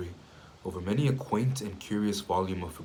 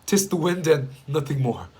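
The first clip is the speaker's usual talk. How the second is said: louder, higher declaration; insistent exclamation; 7 semitones higher, 7 dB louder than usual. A narrator's reading. Narrator reassuring himself about the tapping, loudly insistent. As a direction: declare it firmly to dismiss the fear.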